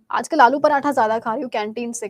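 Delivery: ordinary talk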